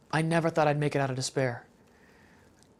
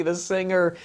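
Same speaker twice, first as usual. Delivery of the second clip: usual speaking voice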